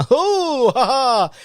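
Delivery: very high whooping laugh; hammed-up whoop of a laugh; laughing along. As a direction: join the dads' laughter over the top. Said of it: "I'm one of them".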